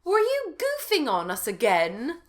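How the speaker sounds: Sherry. A narrator's reading. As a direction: draw it out as an acted accusation. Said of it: "said Sherry, obviously".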